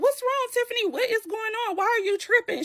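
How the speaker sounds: high-pitched, incredulous questioning; alarmed confronting. Retold or imitated. Amber asks Tiffany what is wrong, alarmed. Acted pool quarrel, friend confronts.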